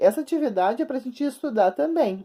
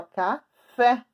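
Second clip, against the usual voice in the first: "café" slowly split, ca ... FÉ; syllable by syllable. Shown as a demonstration. Teacher telling pupils to look closely, "café" broken in two. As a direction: pause inside "café" before a firm "fé". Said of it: "como é que eu falo a palavra".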